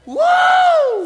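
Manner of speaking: high, loud shout of wow; loud triumphant wow. Shown as a demonstration. Preacher demonstrating a loud shout of triumph. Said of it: "to address a loud tone".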